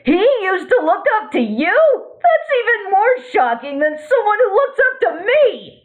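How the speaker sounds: laughing high through the words. Acted. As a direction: laugh while talking. Quark laughs at Ratchet's remark.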